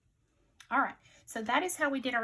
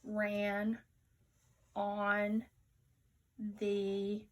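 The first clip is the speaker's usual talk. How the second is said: one word at a time, long gaps; slow choral reading; much slower than usual. Shown as a demonstration. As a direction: lead the class word by word. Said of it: "Say it with me".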